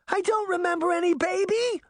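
put-on high shout of a girl; little girl shouting back. A narrator's reading. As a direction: cry out in denial. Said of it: "Red shouted".

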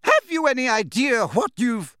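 high, furious outburst cut short; outraged high bellow. A narrator's reading. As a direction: rage at them until interrupted. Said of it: "bellowed Professor McGonagall".

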